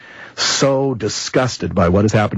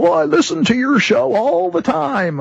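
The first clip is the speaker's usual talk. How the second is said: put-on old man's voice. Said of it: "And he goes".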